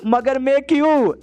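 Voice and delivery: a reluctant, high-pitched "why me"; protesting. A narrator's reading. Voiced character protests being ordered.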